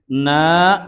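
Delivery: na with a long drawn-out a; long vowel. Shown as a demonstration. Teacher shows the pronoun's long vowel, drawn-out na. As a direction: hold the vowel of na, rising. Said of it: "nanya panjang ya".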